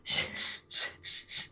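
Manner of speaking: soft unvoiced shh, repeated; shushing demo. Shown as a demonstration. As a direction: show the listener the calming shush. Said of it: "just say".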